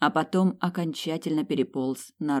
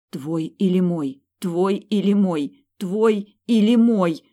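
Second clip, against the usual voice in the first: slow chant building louder and higher; pulsing refrain; 4 semitones higher, 7 dB louder, slower than usual. A narrator's reading. Choice pounds in her head, slow swelling repetition. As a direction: repeat it slowly, each round more insistent. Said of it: "крутилось в голове, пульсировало в висках".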